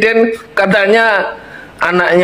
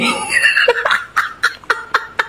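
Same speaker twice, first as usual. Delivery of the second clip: breathless laugh; 6 dB quieter than usual. Real, not acted. Laughing at confusing politics.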